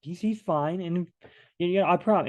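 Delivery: high mock-reassuring teacher voice. Teacher reassuring a parent about Billy, high and hasty. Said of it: "The teacher goes".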